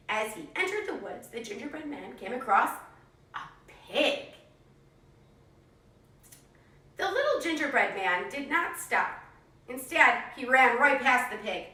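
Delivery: put-on lively read-aloud for young kids; animated picture-book narration; storybook reading. A narrator's reading. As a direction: tell it like a classroom story time. Teacher reads story to class.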